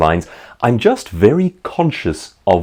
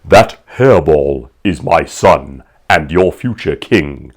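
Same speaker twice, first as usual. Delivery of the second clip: lower, louder, slow regal king voice; James Earl Jones as Mufasa; 4 semitones lower, 4 dB louder, slower than usual. Retold or imitated. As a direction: boom it out like a lion king. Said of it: "James Earl Jones responds with".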